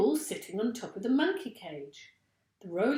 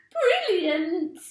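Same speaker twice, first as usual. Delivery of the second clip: high, loud delighted cry; Missus Twit crying out; 10 semitones higher, 9 dB louder than usual. A narrator's reading. Wife loving the gun plan, high and loud. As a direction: shriek with glee at the idea. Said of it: "cried Missus Twit".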